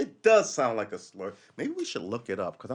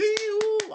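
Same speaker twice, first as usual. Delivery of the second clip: very high squeaky ee-oo; mock shocked squeal; 4 dB louder than usual. Imagined crowd member reacting, mock squeal. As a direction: squeal like a startled prude. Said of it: "I'm not gonna be like".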